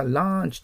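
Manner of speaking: ランチ with a long open "ah"; variant pronunciation. Shown as a demonstration. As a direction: say ランチ as "laanch". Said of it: "という言い方をする人もいる".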